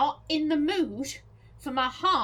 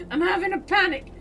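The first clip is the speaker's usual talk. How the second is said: louder, fast "I'm having a panic"; half-mock panic; 5 semitones higher, 7 dB louder, faster than usual. Real, not acted. Player panicking at a threat, half joking. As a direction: blurt it in a rising panic.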